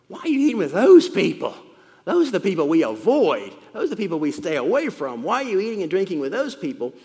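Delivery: sharp grumbling protest at Jesus; indignant Pharisees. Acted. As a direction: scold like a self-righteous Pharisee. Preacher voices grumbling Pharisees.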